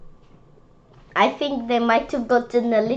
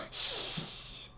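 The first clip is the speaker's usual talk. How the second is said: shushing whisper; 11 dB quieter than usual. Acted. Kid acting the librarian, whispering shh. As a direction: gasp then a whispered shh.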